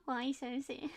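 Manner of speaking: put-on soft Scouse accent; quiet little Scouse villain aside; Scouse Joker. Streamer still giggling at the joke, soft Scouse Joker. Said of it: "What if the Joker was Scouse?".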